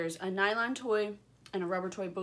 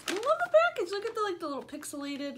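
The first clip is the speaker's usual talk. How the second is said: excited; 11 semitones higher, faster than usual. Real, not acted. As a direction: gush over the cute package. Delighted by pixel art packaging.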